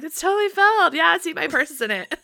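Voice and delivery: high, loud mock insistence; playful calling out. Two fans covering the empty seat, playful high call. Retold joint call to performer.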